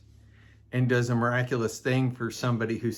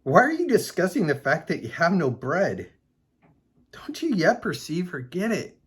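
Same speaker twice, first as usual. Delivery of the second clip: higher-pitched, exasperated questioning; incredulous scolding; 4 semitones higher than usual. Acted. Reads Jesus scolding the disciples.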